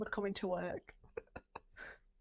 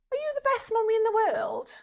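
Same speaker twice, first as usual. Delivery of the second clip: put-on higher, childlike question; little boy sweetly asking mum; sweet child voice; 12 semitones higher, 4 dB louder than usual. Higher child voice asking mum sweetly.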